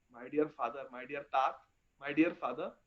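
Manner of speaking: soft, low-pitched address to a father; tender appeal. Acted. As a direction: coax gently, well under full voice. Krishna coaxing his father.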